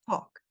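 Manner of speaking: "talk" with one open O, no L. Shown as a demonstration. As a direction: say "talk" as "tók", the L silent. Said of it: "não existe esse som no inglês".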